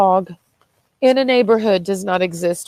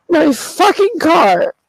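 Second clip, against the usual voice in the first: high, loud, slow tearful outburst; crying; 7 semitones higher, 5 dB louder, slower than usual. Real, not acted. Lamenting the car to viewers, crying loudly. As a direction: cry it out, drawn out and loud. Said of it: "'cause I'm crying".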